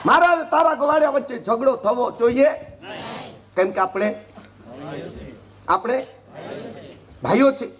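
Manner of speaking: preaching shout. Real, not acted.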